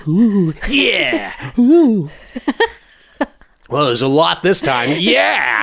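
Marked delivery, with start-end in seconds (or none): 0.1-2.1 moaning ooh. Retold or imitated. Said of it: "So I'm like".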